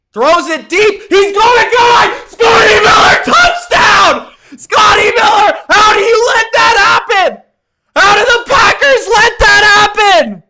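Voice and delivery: high, loud, real shouting at a huge play; excited shouting. Real, not acted. Streamer reacting to a last-second touchdown, shouting excitedly. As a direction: yell it out like the game just turned.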